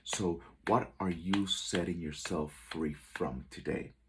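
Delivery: ordinary talk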